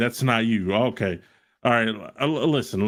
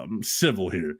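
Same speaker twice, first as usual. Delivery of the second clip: usual speaking voice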